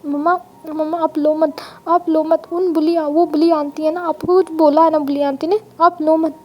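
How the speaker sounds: put-on a small child begging mommy not to cry; little boy voice. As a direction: toddler pleading with his crying mother, lisping. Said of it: "तब Ivan अपनी mom के पास आता है और कहता है".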